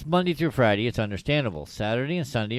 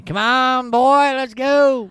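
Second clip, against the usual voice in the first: high, loud, slow call; calling a dog; 12 semitones higher, 8 dB louder, slower than usual. Retold or imitated.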